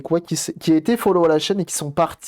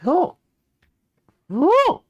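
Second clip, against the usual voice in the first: a sharp, much higher Oh of wonder; amazed gasp; 5 dB louder than usual. Real, not acted. Streamer sees the console, amazed cry.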